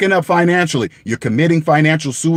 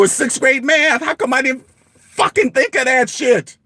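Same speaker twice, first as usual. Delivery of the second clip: higher, heated outburst at himself; angry; 6 semitones higher than usual. Real, not acted. Scolding himself over a simple sum, angry. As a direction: let it burst out, frustrated. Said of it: "It makes me angry".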